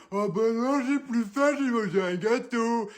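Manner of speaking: put-on high whiny child voice, slow at the end; mocking the son. Mother mimicking her son's whiny excuse, high and slow. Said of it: "après me dire".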